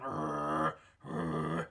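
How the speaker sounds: very low rolled grunt; throat grunt. Shown as a demonstration. Coach showing what not to put into the call, throat grunting. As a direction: growl "Rrr" deep in the throat. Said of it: "that kind of throat noise".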